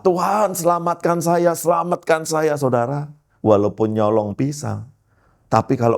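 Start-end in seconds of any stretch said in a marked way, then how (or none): 0.0-2.6 high-pitched retold plea to God; acted-out urgent praying; desperate prayer. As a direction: beg to be rescued, pitched well up. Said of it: "saya berdoa".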